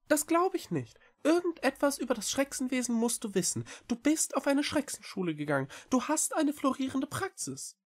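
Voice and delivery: very high, quick small-creature voice; little cat Echo, earnest. A narrator's reading. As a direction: plead eagerly in a high, fast voice. Cat insists. (hohe, eilige Katerstimme)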